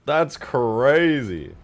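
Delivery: loud, high mock astonishment; hammed-up amazement. Streamer reacting to a viewer's bribe to play, hammed-up amazement. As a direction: blow it up big, drawing out the last words.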